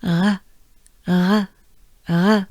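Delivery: "re" as a short French R on an out-breath. Shown as a demonstration. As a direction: take a breath, then exhale each "re", tongue down. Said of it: "this is the French R".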